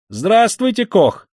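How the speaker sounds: high, ringing hail; cheerful loud greeting. A narrator's reading. Young newcomer greeting Koch at the door, cheerful ringing shout. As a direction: hail an acquaintance merrily and loudly. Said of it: "звонко и весело закричал подошедший".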